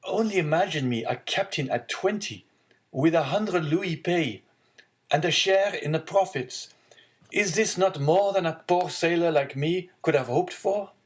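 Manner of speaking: proud, hopeful son; young man voice. Acted. Young sailor speaking to father.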